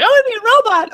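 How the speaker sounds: put-on high, loud, eager child's voice; kid voice. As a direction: squeal it like an excited little boy. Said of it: "my son was like".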